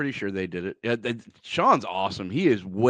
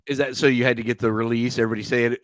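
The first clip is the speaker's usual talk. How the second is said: ordinary talk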